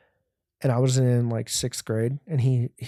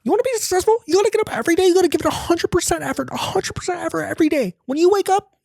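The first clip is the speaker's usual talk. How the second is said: very high, fast hype-man voice; Gary Vee impression; faster than usual. Mocking a motivational speaker with a very high, fast hype voice. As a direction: ham up a pushy motivational guru. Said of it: "Gary Vee would be like".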